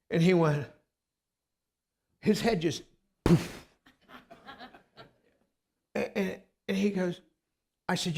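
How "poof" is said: short puffed burst of air; a quick mouth-made poof; imitated explosion. Preacher showing a man's head going poof, imitated blast.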